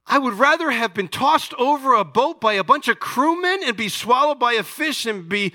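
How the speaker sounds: high, loud mock rant; acting out an indignant complaint to God; angry Jonah. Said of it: "he's so angry".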